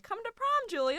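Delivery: very high, loud mock coaxing; high-pitched impression of eager friends; imitated pleading invitation. School friends urging her to prom, high mock coaxing.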